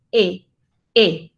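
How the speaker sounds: "E" as the Spanish vowel, shaded slightly toward I; E con algo de I. Shown as a demonstration. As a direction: model an isolated "E" with a little I in it. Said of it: "tiene un poquitico de I". ("E" española con un poquitico de I)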